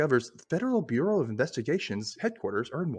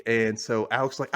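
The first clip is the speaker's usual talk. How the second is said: ordinary delivery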